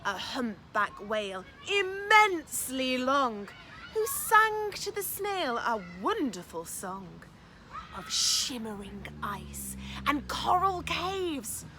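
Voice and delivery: put-on picture-book reading for children; lively storytime narration; story narration. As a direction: read it aloud with wonder for little ones. Reads picture book aloud.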